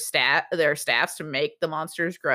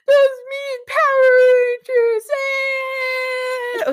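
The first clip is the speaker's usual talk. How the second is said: high, loud, drawn-out fake crying; whiny kid villain; 6 dB louder, much slower than usual. Robot child sent in to manipulate Auric, mock bawling. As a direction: wail it like an obnoxious bratty child. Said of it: "you send the kid in ... he's just like".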